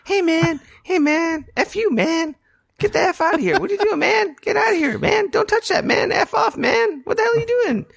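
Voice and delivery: very high-pitched squealing character voice; mock yelling in a strained falsetto; Morty yelling. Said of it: "a character, like, yelling at me".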